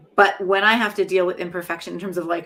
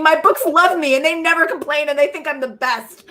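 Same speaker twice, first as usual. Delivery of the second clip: laughing joke; 8 semitones higher, 8 dB louder than usual. Real, not acted. Joking about books vs son.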